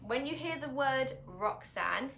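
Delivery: ordinary talk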